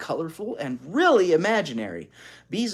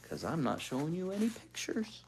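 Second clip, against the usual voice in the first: whisper; 3 semitones higher, 10 dB quieter than usual. Real, not acted. Whispered aside.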